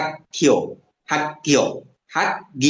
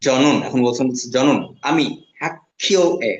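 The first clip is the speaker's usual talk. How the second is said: ordinary talk, nothing marked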